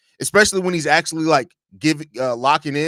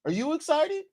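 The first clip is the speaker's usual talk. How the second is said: high mock kid voice, rising; mimicking kid; 13 semitones higher, 7 dB quieter than usual. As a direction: echo the kid's question in a high voice. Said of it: "did he ask you, "Are you excited?".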